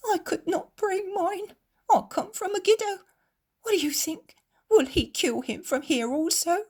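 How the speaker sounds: high, tearful pleading moan, slowed; a mother crying out her worry in sobs; high tearful voice breaking with grief; weeping moan. A narrator's reading. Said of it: "moans a woman whose face is red with weeping".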